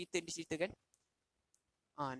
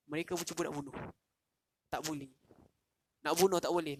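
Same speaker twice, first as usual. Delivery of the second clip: ordinary delivery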